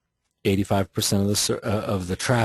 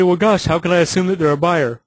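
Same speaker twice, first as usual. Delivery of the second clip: higher, louder, mock skeptical agent asking; mock doubtful agent; 9 semitones higher, 7 dB louder than usual. An agent objecting in the lesson, mock skeptical and loud. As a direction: play the doubting agent, pitched up. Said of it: "you say".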